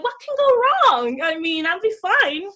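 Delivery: high, loud, blithe mock optimism; high-pitched retold confidence; breezy overconfidence. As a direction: toss it off loudly, sure nothing can go wrong. Retells her naive confidence before Japan.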